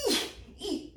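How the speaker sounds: high, soft eek noises; little squeaky strain. Retold or imitated. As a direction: squeak out two small efforts. Straining on skis, acted.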